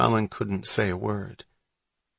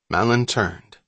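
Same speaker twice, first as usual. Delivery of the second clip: plain reading voice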